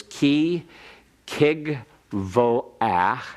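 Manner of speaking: slow, careful Hebrew reading; slow reading aloud. Shown as a demonstration. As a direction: read each word slowly. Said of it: "Let me read it one more time".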